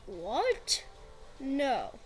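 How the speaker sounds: ordinary talk, nothing marked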